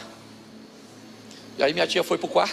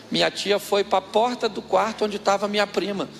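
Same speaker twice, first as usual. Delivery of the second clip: ordinary talk, nothing marked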